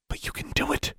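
soft, coaxing whisper; hushed, breath-only persuasion. A narrator's reading. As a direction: urge it under your breath. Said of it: "she whispered".

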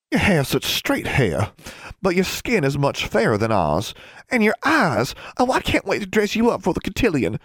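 put-on high, girlish cooing excitement; doting sister bursting with plans; gushing older sister. Julia fusses over Alexandra.

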